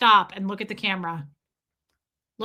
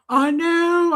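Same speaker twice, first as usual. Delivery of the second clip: eager I know; 7 semitones higher, 4 dB louder than usual. Retold or imitated. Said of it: "And I said".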